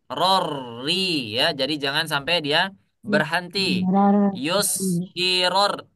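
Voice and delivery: ordinary talk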